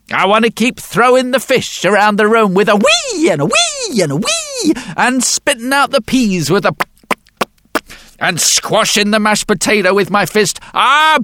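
put-on mischievous old man. A narrator's reading. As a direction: bargain like a naughty grinning old man. Old man gleefully states his condition.

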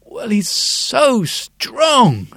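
put-on high, slow child's voice; proud little girl boasting; bright little girl. The girl describes her daddy to the sculptor, high and slow. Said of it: "brightening up no end".

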